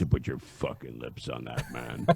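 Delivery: deep low announcer growl; booming voice of God. Retold or imitated. Imitating Ernie scolding the teen over the cigarette, deep rumble. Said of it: "Like the voice of God".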